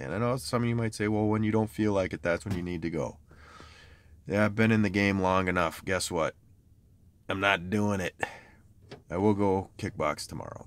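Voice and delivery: ordinary delivery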